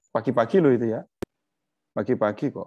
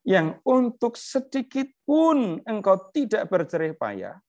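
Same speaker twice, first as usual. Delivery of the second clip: slow, much higher, grand divine voice; God reasoning with Jonah, solemn and lofty; 9 semitones higher, slower than usual. Acted. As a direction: speak as God gently rebuking Jonah. Reads God's words from scripture.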